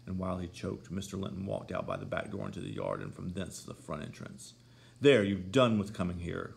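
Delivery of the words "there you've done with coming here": louder cry of alarm. A narrator's reading. Catherine scolds Heathcliff for striking Edgar, loud cry. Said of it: "cried Catherine".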